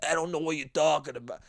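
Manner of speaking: low, mumbled goomba voice; Italian goomba. Acted. A dumb Italian out there, hammed-up low goomba voice. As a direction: put on a dopey goomba mumble. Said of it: "There are some Goomba".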